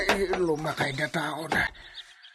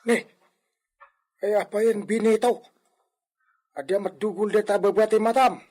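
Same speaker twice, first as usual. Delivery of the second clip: ordinary delivery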